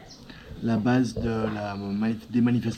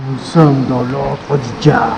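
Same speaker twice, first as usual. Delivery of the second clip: dramatic narrator; 10 dB louder, slower than usual. Pretend. Dramatic announcement entering cave.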